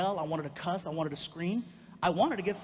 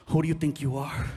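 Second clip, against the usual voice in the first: loud, low challenge; acted outburst at an absent father; angry confrontation; 4 semitones lower, 6 dB louder than usual. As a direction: confront him head-on, voice dropped low. Confronting his dad in the retold story.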